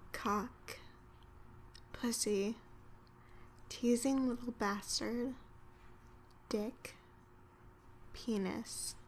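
soft, sultry and seductive; sexy. Shown as a demonstration. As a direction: purr each word to tempt a lover. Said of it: "I would do it with a little bit more, um, sexiness".

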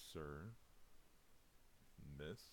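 ordinary talk

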